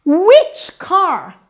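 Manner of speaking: "Which" loud and lifted, "car" falling away; rise on WHICH. Shown as a demonstration. Teacher demonstrates question melody, rise on which. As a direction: start high and strong on "Which". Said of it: "o which já sobe".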